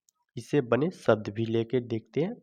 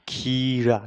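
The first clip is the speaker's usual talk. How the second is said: खीरा loud, with ee drawn out; stretched long vowel; 6 dB louder, much slower than usual. Shown as a demonstration. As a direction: hold the first vowel of खीरा firmly. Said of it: "इसे थोड़ा खींच करके sound आता है".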